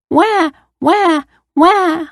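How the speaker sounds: put-on high-pitched little girl wail; mock crying. A narrator's reading. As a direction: imitate a child's wah-wah cry. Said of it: "answered the girl".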